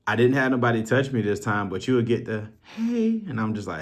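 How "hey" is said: high, drawn-out mock female hey; sing-song it high like an admiring woman; put-on high flirty voice; imitated flirty greeting.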